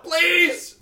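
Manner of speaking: high pleading shriek; desperate scream. Pretend. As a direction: beg in mock agony. Pug begging in agony.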